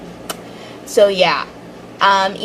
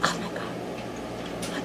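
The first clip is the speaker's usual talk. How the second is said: soft unvoiced squeal of excitement; a fan calming herself down in a whisper; whispered fangirling; 15 dB quieter, much slower than usual. Real, not acted. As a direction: whisper it, barely holding it together. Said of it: "calm, calm down".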